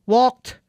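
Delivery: "walked" high and loud, ending in t; native speaker's correction. Acted. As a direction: correct it to "walked" with an emphatic final t. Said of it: "disuruh benerin". ("walked" tinggi dan lantang, t jelas)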